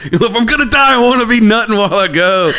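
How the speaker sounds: high, strained comic outcry; mock defiant student. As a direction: play a student shouting back in mock panic. Imagined defiant student answers back.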